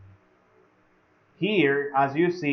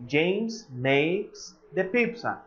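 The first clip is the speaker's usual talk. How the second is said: each word laid out slowly for students; slow repeated model sentence; 3 semitones higher, much slower than usual. Shown as a demonstration. Teacher repeating the sentence slowly for the class. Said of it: "if I say".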